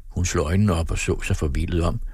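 ordinary delivery

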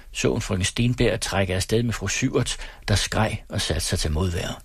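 ordinary delivery

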